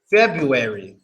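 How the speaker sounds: February as fɛbɹuɛɹi, the r fully said; casual February. Shown as a demonstration. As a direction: say February with the first r sounded. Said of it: "We pronounce this word like this".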